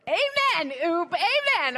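high, loud, exultant amen; joyful amen. Retold or imitated. Preacher cheering a friend's puzzling wisdom, high and loud. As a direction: throw up a happy amen.

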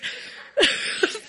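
a high, silent, wheezing laugh; airless giggle. Real, not acted. She laughs silently at herself before the group.

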